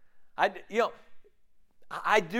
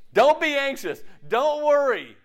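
mock dismissive; 8 semitones higher, 7 dB louder, slower than usual. Mocking the verse's advice.

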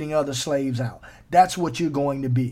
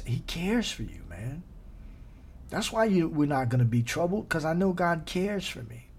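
tender ministering; 3 dB quieter than usual. Real, not acted. Tender soft ministering to listeners.

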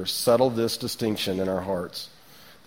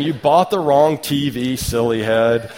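mocking child; 3 semitones higher, 6 dB louder, slower than usual. Daughter teasing her dad over his TV, mocking. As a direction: imitate a daughter's loud teasing. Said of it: "mocking him".

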